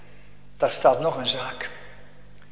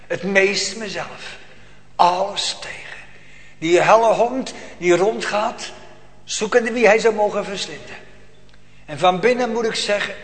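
preaching at full force; 6 semitones higher, 7 dB louder than usual. Acted. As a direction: thunder out the struggle of faith. Preacher builds to a climax. (hoog en luid preken, vol vuur)

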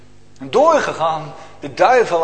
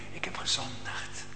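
whispered, much softer and lower; whispered confession; 5 semitones lower, 12 dB quieter than usual. Acted. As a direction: whisper it in shame. Voiced sinner's confession.

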